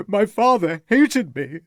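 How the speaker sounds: distraught youth crying out. A narrator's reading. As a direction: break down over the father.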